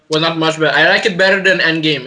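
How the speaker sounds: ordinary talk, nothing marked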